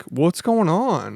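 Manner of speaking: slow, drawn-out dopey jock voice; clueless jock. Retold or imitated. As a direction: imitate a jock who has no idea, dragging the words. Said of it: "kind of like a jock".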